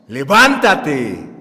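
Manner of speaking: loud, drawn-out order to rise; confident command. Retold or imitated. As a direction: call it out slowly with full authority. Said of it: "con voz segura le ordenó".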